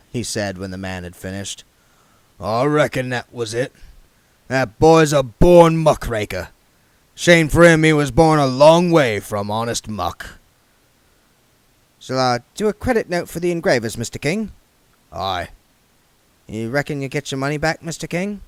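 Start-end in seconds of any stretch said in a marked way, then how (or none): 2.4-10.4 a higher, louder put-on voice for the scrap king; the king sizing up the young man; Harry King voice. 12.0-18.4 higher, deferential questions to the boss; a careful clerk asking the king; the clerk's voice. Acted.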